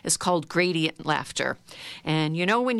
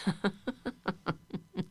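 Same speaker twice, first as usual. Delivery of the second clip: much softer sneaky laugh; quiet laugh; 8 dB quieter than usual. Shown as a demonstration. Teacher leading the sneaky quiet laughter, much softer. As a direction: giggle under your breath. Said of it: "And a little quieter".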